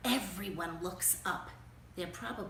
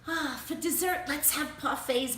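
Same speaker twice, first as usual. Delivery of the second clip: high, louder, hammed-up fancy mother; fancy mom; 5 semitones higher, 6 dB louder than usual. Mom ordering dessert, hammed-up posh voice. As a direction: put on airs like a grand lady. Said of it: "my mom says".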